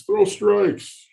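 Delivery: high-pitched heckling parent; mom in the stands. Retold or imitated. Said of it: "It's the mom in the stands".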